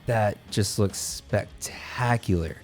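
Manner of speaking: soft, slow, breathy amazement; a hushed, slow marvel at the top; hushed awe. Real, not acted. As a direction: gasp it out quietly, stunned by the bass. Marvelling at a viewer's bass.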